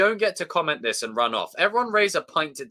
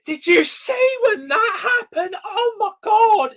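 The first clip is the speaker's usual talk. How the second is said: very high, slow, loud mock shriek; gushing cinemagoers; 7 dB louder, slower than usual. Imitating crowd coming out of a screening, shrieking excitedly. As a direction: squeal it like an overexcited fan. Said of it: "they were all coming out going".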